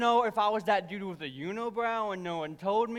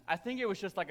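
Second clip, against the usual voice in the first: usual speaking voice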